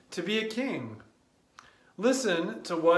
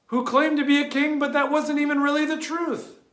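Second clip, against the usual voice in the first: mock sneer; 9 semitones higher, 4 dB louder, faster than usual. Sneering at the claimed king.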